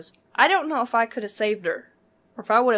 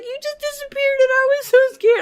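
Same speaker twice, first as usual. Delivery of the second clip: much higher and louder, frightened cry; near tears, scared; 9 dB louder than usual. Retold or imitated. To friend who vanished underwater, near tears. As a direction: blurt it out on the verge of tears. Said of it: "I was, like, on the verge of crying".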